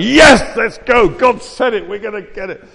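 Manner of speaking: loud, high-pitched gung-ho cheer; rallying enthusiasm. Acted. Two believers urging the group onward, excited and loud. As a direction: burst out eager and all in. Voicing the two eager spies.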